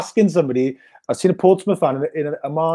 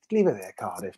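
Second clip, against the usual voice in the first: much lower and softer, a quiet threat; menacing warning; 12 semitones lower, 10 dB quieter than usual. Retold or imitated. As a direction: warn them off low and quiet. Rival fans warning them off.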